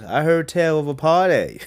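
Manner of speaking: a hint of the vampire's charming entrance line; imitating Remick the vampire. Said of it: "intro scene to the juke joint when he goes".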